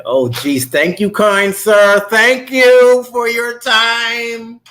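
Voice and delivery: high, slow, sing-song gratitude; drawn-out, over-sweet thank you; hammed-up polite thank you; mock gushing thanks. Mock grateful thanks to father.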